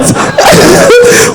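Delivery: high, tearful burst of laughter; emotional laugh. Real, not acted. Embarrassed questioner in front of the crowd, a high tearful laugh. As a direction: break into a laugh while welling up. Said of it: "I'm just being emotional".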